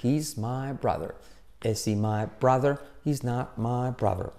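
slow, evenly beaten chant; rhythmic drill. Shown as a demonstration. Teacher practising three variations with rhythm, slow rhythmic chant. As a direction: tap out each phrase on a steady beat. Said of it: "practicar así con ritmo".

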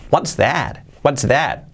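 ordinary delivery

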